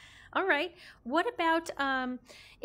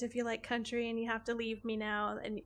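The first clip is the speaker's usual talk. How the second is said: ordinary talk, nothing marked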